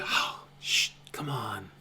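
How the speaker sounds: whispered shush, then a soft coaxing; low, softly breathed hush. Acted. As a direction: gently shush someone at night. Sashay hushes Cuddles at night.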